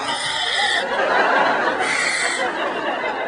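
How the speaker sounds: long, voiceless huff into a cupped hand; breath-check exhale. Shown as a demonstration. Comic showing the failed hand test, long huff. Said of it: "do that hand thing".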